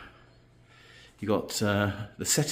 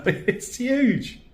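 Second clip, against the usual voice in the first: amazed. Real, not acted. Reacting to huge shower.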